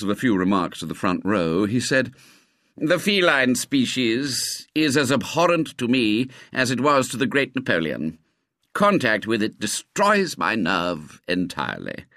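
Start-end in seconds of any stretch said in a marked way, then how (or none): 2.8-12.0 pompous schoolmaster. Acted. Narrator voices pompous schoolmaster.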